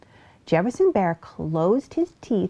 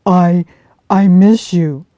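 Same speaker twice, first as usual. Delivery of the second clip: gentle bear voice; 12 dB louder, slower than usual. Acted. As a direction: comfort her as a big bear. Bear voice in story.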